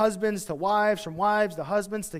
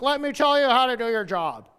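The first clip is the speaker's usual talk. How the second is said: higher, louder, pushy mock voice; bossy know-it-all; 5 semitones higher, 5 dB louder than usual. Mocking churchgoers lecturing their boss, bossy voice. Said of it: "We're like".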